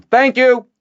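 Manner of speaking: loud, high shouted thanks; shouting. A narrator's reading. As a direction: shout it down the shaft. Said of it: "and shouted down".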